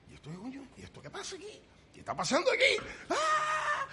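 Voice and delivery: high, excited mock disbelief; retelling a stunned, delighted reaction with a laughing shout; surprised exclaiming. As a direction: squeal it out in delighted amazement. Retold own stunned reaction.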